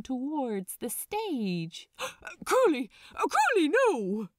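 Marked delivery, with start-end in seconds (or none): 1.9-2.4 sharp, soft intake of breath; shocked gasp. Acted. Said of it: "in self-conscious horror". 2.4-4.3 much higher and louder, flustered protest; horrified exclamation. Acted. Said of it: "exclaimed the angel in self-conscious horror".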